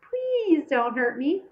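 a touch of mock pleading girl; mocking mimicry. A narrator's reading. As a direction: ape her plea, mocking. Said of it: "He mimicked Martine's ... voice".

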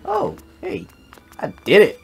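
ordinary talk, nothing marked

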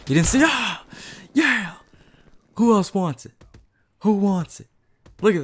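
high, loud hype shouting; yelling in triumph mid-game; excited yell. Real, not acted. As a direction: celebrate the kill loudly. Excited kill streak in game.

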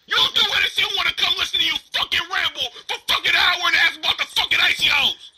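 yelling over her in a real argument; angry shout. Real, not acted. Heated argument with Dia.

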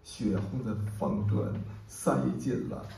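declaimed verse, swelling into urgent questions and falling back to hush; impassioned poem recitation. Acted. Poet shares a short, powerful poem with an audience, declaimed passionately. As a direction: recite with dramatic surges and hushed pauses.

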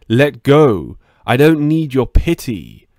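loud, furious outburst; pinned to the counter, she shouts him off; angry yell. Acted. Pinned, yelling at attacker.

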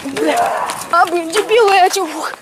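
usual speaking voice

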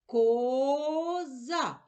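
Коза stretched slowly with a long о; Коооза, drawn out for listening; drawn-out word. Shown as a demonstration. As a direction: sound out Коза slowly, holding the first syllable Ко. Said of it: "Який перший чуємо звук".

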